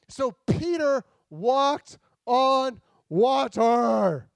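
slow, loud, every word of Peter walked on water stressed; all caps emphasis. Shown as a demonstration. Preacher telling the church Peter walked on water, slow and loud. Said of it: "All caps".

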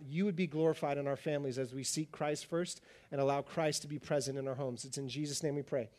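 normal talking voice

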